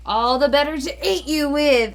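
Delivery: loud triumphant wolf yell. Acted. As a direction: belt it out like a pouncing wolf. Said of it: "the wolf yelled".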